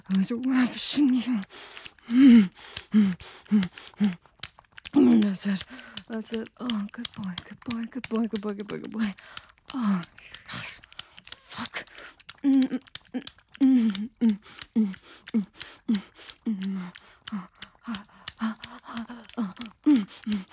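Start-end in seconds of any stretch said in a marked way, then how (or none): 2.1-4.2 loud rising moans; loud hummed moans. Acted. Moaning during the act. 12.4-20.4 moaning. Acted. Moaning during the act.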